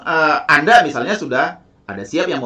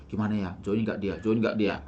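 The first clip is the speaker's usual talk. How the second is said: anxious and softer, muttering to yourself; worried, much softer murmur; nervous tone; 6 semitones lower, 9 dB quieter than usual. Shown as a demonstration. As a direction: fret under your breath whether they'll join. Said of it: "jangan seperti nadanya".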